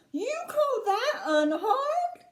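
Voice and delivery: slow, high-pitched outrage; indignant snap. A narrator's reading. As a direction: stretch it out in indignant disbelief. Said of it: "snapped Missus T V".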